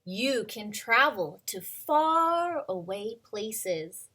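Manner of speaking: slow, bright storybook read-aloud; reading to children. Acted. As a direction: read it like a picture book to little kids. Reading picture book aloud.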